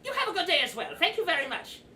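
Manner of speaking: high, loud goblin voice; see off a customer as the goblin; goblin clerk's send-off. Acted. Goblin replies to departing customer.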